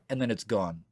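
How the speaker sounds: normal talking voice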